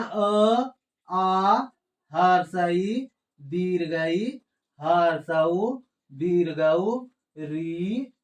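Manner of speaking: vowels from अ to अह recited one by one, lengthened; pronunciation demonstration. Shown as a demonstration. Teacher showing learners each vowel sound, deliberate recitation. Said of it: "उच्चारण इसका कैसे किया जाता है".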